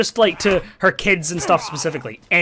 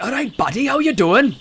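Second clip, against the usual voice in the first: put-on higher, rising Welsh old man's greeting; Welsh granddad impression; 8 semitones higher than usual. Granddad greeting the grandson, in a high Welsh accent. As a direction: put on a big old Welsh guy's hello. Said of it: "And he just like".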